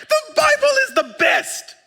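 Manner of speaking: squealing high with laughter; delighted laugh through words; real laughing joy. Real, not acted. Laughing delight after reading.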